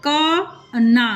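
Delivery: का ना drawn out slowly, syllable by syllable; sounding out का ना slowly; stretched syllables. Shown as a demonstration. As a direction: stretch each sound of का ना apart. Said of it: "खींच के बोला".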